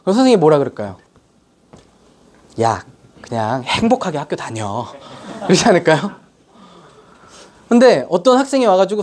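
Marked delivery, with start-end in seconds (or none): 2.6-4.9 low, slow, softer brush-off; dismissive teacher. Acted. Teacher sending a hopeless student away, low and dismissive. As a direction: let the kid down lazily, dropping the pitch.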